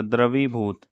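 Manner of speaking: usual speaking voice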